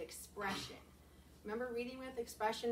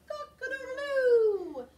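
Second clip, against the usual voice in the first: high, loud, slow crow; rooster crow; 14 semitones higher, 11 dB louder, much slower than usual. Shown as a demonstration. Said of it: "hear some expression".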